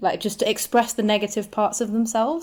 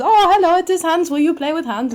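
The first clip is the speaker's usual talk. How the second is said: put-on high, loud, creepy little German child; Hans the Little German Boy; 10 semitones higher, 6 dB louder than usual. Sneaking up on partner to play, high German boy voice. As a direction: pipe up as an eager German boy. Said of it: "Do the little German boy".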